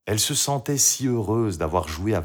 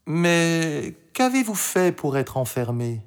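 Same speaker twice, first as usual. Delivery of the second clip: put-on high girl's voice, unforced volume; Marie; 9 semitones higher than usual. A narrator's reading. Marie asks the prisoner what he did, high and curious. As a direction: ask with innocent curiosity.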